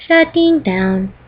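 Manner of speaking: very slow, drawn-out machine voice; robot shutdown voice. Retold or imitated. Wishing her computer talked, slow robotic imitation. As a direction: stretch it out like a computer powering off. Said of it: "I did that impersonation".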